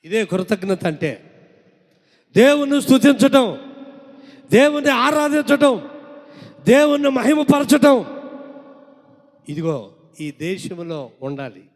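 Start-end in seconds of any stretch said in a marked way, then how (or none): 2.3-8.2 high, loud, ringing declarations; preaching at full force. Acted. Preacher loudly declares praise to God to the congregation. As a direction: drive each line home to the church.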